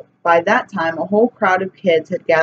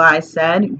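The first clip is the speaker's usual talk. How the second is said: usual speaking voice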